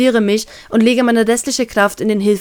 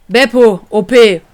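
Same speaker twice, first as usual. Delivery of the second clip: louder calm call across the deck; captain calling across the deck, calm raised voice; calm but raised; 6 dB louder, slower than usual. Acted. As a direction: call the crew, steady. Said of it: "ruhiger, aber leicht erhobener Stimme".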